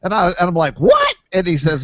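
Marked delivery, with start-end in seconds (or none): 0.8-1.1 huge leap up in pitch, louder; shocked what. Retold or imitated. Hearing the driver loves his plane, shocked high yelp. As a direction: yelp it in total disbelief.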